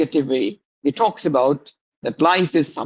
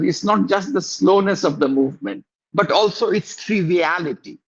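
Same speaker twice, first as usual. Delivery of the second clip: ordinary delivery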